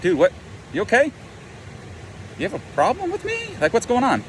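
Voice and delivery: high-pitched incredulous challenge; mock confrontation. He confronts an imagined person with attitude, incredulous challenge. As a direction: act put out and question them, voice jumping up.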